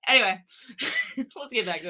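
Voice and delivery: giggling through the words; amused aside breaking into laughter; laughing. Real, not acted. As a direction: talk while cracking up. Laughing during a joking aside.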